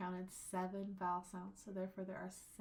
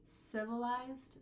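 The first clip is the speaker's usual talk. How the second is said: "Civilized" slow, first syllable weighted; stress on CIV; 3 semitones higher, slower than usual. Shown as a demonstration. Teacher contrasting stresses, weight on first syllable. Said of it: "the stress is somewhere a little bit different".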